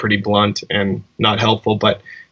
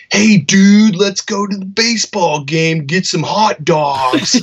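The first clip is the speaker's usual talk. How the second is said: high, loud exaggerated American dude voice, slowing on hot dogs; hammed-up American accent, Aussie doing a Yank; mock American accent; 8 semitones higher, 4 dB louder, slower than usual. Australian tour manager mocking Americans in a loud American accent. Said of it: "he would do an American accent".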